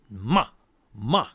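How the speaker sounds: MA loud and falling sharply; fourth tone. Shown as a demonstration. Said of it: "fourth tone is the one that drops in pitch".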